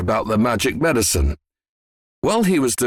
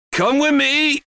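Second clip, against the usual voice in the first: high, urgent command; excited yell. A narrator's reading. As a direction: call out eagerly, pitched right up. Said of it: "Mister Cranky yelled".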